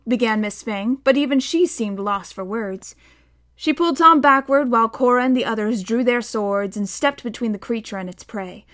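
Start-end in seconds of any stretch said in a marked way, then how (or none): none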